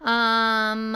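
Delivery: ordinary talk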